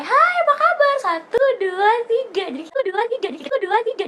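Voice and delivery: high, loud counting the cheek kisses; playful high greeting; excited greeting. Retold or imitated. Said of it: "kalau di sini itu kayak".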